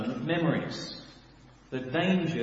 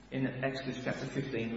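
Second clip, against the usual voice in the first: ordinary delivery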